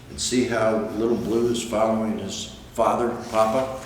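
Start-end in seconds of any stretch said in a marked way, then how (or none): none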